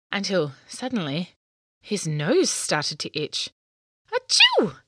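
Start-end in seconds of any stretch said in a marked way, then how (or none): 4.1-4.8 high loud sneeze; big sneeze. A narrator's reading. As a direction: sneeze it out sharply.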